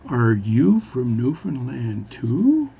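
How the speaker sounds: stretched question with a long rising end; really slow speech. Acted. Newfie asking the bartender back, really slowly. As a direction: draw out every word, swooping up at the end. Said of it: "is speak really slowly".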